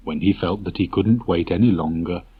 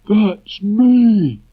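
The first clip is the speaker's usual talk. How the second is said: very high, loud, gloomy donkey drawl; a put-upon old donkey greeting; Eeyore voice; 9 dB louder than usual. Acted. Eeyore greets the visitors walking up, high and loud.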